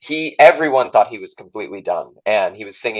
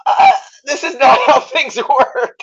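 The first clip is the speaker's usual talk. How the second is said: slow, high laughing exclamation; stunned laugh, drawn out up high; laughing shock; 5 dB louder, slower than usual. Retold or imitated. Actor stunned by the offer, laughing loud.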